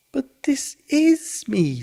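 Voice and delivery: high, slow, puzzled surprise; very much surprised. A narrator's reading. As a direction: draw it out, baffled that anyone doubts him. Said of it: "very much surprised".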